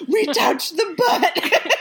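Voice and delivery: slow, loud, giggling triumph; laughing. Retold or imitated. Friends bragging about the photo, laughing triumph. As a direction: savour each word through laughter.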